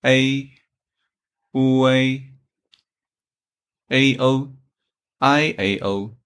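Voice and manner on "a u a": "A, U, A" as level Mandarin finals ei, uei; Chinese pronunciation. Shown as a demonstration. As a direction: model "A" as ei and "U, A" as one gliding uei. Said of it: "In Chinese".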